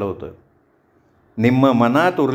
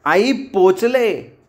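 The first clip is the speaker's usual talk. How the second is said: higher, drawn-out happy call; loud announcement; 5 semitones higher, much slower than usual. A narrator's reading. Daughter phoning mother on arrival, loud. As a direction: call it out loudly and slowly. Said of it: "तृप्ती जोरात म्हणाली".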